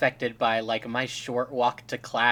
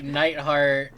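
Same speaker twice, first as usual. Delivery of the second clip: usual speaking voice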